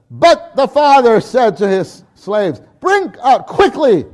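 loud, slow, high urgency; father ordering slaves to hurry; urgent command. Acted. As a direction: call for the robe at once.

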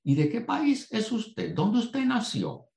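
put-on a rental clerk amazed at the accent; surprised clerk. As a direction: play the baffled rental clerk. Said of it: "el individuo me dice".

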